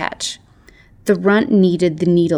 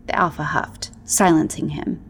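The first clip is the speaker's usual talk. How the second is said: plain reading voice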